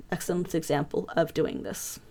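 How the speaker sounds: usual speaking voice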